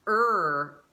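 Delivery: "er" as one long, loud r-coloured vowel; isolated er sound. Shown as a demonstration. Teacher modelling the sound to practise, a held American "er". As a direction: sustain "er" alone with a strong American r.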